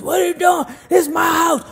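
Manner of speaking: high, loud mock boyfriend yell; a hammed-up furious partner; angry boyfriend. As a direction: bark at her like the jealous man. Partner yelling in her face.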